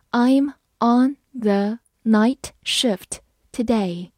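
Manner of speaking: slow, clear model reading. Shown as a demonstration. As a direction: read it slowly word by word. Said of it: "好，慢慢来读。". (慢速朗读)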